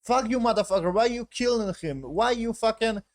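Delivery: high-pitched, heated protest; acted outrage. Himself in the captive's situation, acting outraged. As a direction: play an indignant captive cursing the killer.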